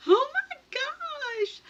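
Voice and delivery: very high, slow, stretched oh my gosh; overwhelmed oh my gosh. Retold or imitated. Calling her daughter about the gift, slow high awe. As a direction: draw it out in awe. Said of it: "I'm like".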